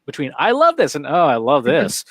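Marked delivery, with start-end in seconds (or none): 0.4-0.9 high, bright-pitched heartfelt "I love this"; sincere delight. Shown as a demonstration. Speaker demonstrating sincere praise for sarcasm contrast, sincere delight. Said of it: "cannot tell the difference between". 1.1-2.0 dry sarcastic "Oh, I love this". Shown as a demonstration. Speaker demonstrating what machines cannot detect, sarcastic. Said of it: "is sarcasm".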